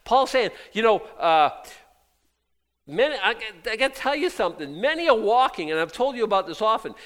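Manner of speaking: loud, high, fired-up preaching; fiery preaching. Acted. Pastor refusing a million-dollar check, fiery preaching. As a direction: refuse the money with full fire.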